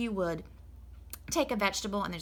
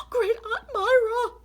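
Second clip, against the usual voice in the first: slow, much higher, louder gasped name; alarmed gasp; 10 dB louder, slower than usual. Acted. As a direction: panic as the guest arrives. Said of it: "said Mister and Missus Rogers".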